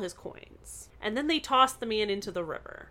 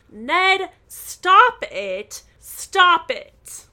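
louder, higher cries of stop it; mock protest shouted at the kidnapper; retold yell; 8 semitones higher, 10 dB louder, much slower than usual. Nancy yelling at Ned as he drags her, loud. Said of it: "while she yells".

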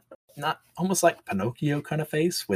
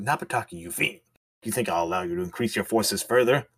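low angry villain; 4 semitones lower, 4 dB quieter than usual. Acted. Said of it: "Magu gets angry".

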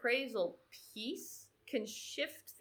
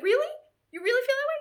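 very high, loud amazed question; astonished disbelief; 11 dB louder, much faster than usual. Retold or imitated. Anxious swimmer hearing another racer is nervous, high-pitched amazement. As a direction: squeal it in mock astonishment.

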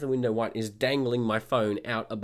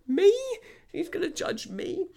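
very high, squeaky disbelief; baffled questioning, voice shot up; incredulous; 5 dB louder than usual. Retold or imitated. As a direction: act out affronted astonishment. Teller retells his disbelief.

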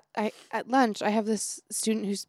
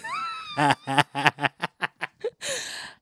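burst of laughter; 12 semitones higher than usual. Real, not acted.

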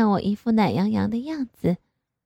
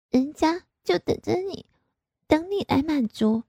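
higher, lightly coquettish; coy daughter; 7 semitones higher than usual.

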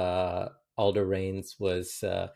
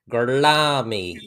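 put-on drawn-out, overdone accent, louder; Brad Pitt's accent; 3 semitones higher, 5 dB louder, slower than usual. Quoting a favourite one-word line, hammed-up accent. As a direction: stretch the word in a mock movie accent. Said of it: "when Brad Pitt's like".

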